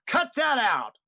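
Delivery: high, loud shout of Cut that out; retold shout. Director yelling at two joking actors, loud and high. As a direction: yell it at the two actors to stop. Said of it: "And he goes".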